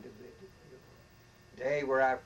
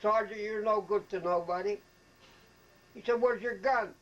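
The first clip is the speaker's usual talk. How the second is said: much higher, louder angry bark; retold colonel yelling; 8 semitones higher, 7 dB louder than usual. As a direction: chew him out like a furious colonel. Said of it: "yelling at everybody".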